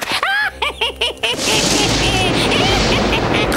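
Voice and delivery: long evil witch cackle. Acted. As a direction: cackle in triumph over him. Witch threatens the soldier.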